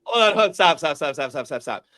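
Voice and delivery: cutting in, hold on and stop stop; laughing protest. Real, not acted. Cohost stops guest's cat story, laughing protest. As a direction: stop him mid-story through laughter.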